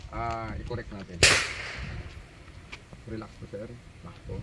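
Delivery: soft, low "relax po" to settle him; calming. Real, not acted. Instructor soothing shooter while guiding his arm, soft.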